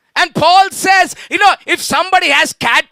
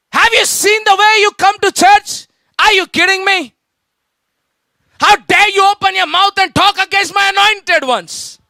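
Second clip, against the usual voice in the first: angry rebuke; 6 semitones higher than usual. Acted. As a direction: blast them like a furious leader. Joshua rebukes the people.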